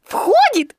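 high squeaky Piglet exclamation; excited cry. A narrator's reading. Piglet watches the balloon go in, excited squeal. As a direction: squeal it out in delight. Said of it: "закричал Пятачок".

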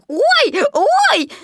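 high, loud little squeals; Piglet crying out. A narrator's reading. Piglet hopping on the bank, alarmed squeals. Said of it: "кричал".

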